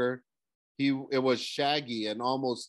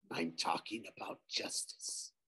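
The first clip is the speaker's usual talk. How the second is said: much softer, low ghostly voice; 5 semitones lower, 14 dB quieter than usual. A narrator's reading. Ghost corrects Nico, soft and ghostly. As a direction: breathe it like a shade.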